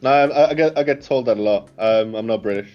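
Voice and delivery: usual speaking voice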